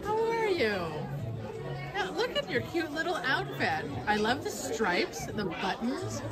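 ordinary talk

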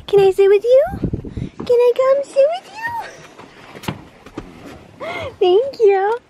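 very high cooing voice. Real, not acted. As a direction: ask the pet sweetly to come sit. Cooing to animals.

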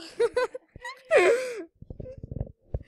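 shrieking laugh close to tears; high crying laugh. Real, not acted. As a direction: laugh till it squeaks.

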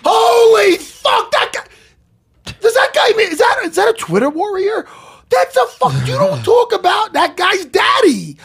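high, loud stunned shouting at the picture; excited shouting. Real, not acted. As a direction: shout it out in real awe. Hyped looking at fight picture.

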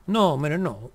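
high, loud no hombre in disbelief; excited protest. Real, not acted. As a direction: burst out in protest. Reacting to game event. (protesta alta y fuerte)

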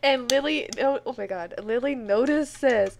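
loud, rapid running commentary of the drama; excited panic. Real, not acted. Gamer watches the husband get jealous, loud excited panic. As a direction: narrate the chaos in loud excitement.

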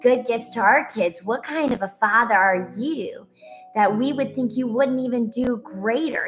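normal talking voice

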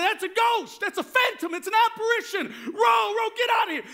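high, loud alarmed cries; acted panic. Preacher as disciples fleeing a ghost on the water, acted panic. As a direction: yell like a boatload of frightened men.

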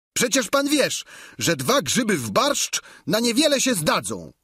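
high-pitched, exasperated retort; excitable high voice, fed up; indignant servant Sancho. Acted. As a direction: answer your master in agitated protest. Sancho answers his master.

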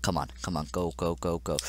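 usual speaking voice

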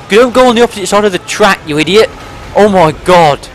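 loud, high-pitched scolding; exasperated yell. Real, not acted. Passenger scolding the driver on the track, exasperated yell. Scolding the teammate driving badly.

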